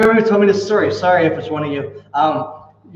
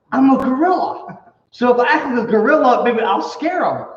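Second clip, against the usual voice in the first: scared inner voice; 7 semitones higher than usual. Acted. Scared man in gorilla suit.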